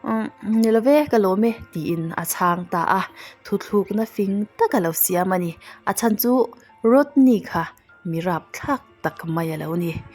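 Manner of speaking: ordinary talk, nothing marked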